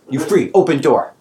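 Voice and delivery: goblin order. Acted.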